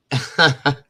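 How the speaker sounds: short, loud burst of laughter; real laugh. Real, not acted. Storyteller amused by own prank story, real laugh. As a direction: crack up mid-story.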